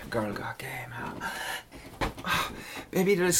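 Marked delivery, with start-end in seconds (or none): 0.1-1.3 playful whisper. Acted. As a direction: tease her in a whisper. Teasing partner after hit.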